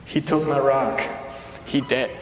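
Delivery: low dumb caveman voice. Pretend. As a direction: talk like a brutish Neanderthal. Caveman bit continues.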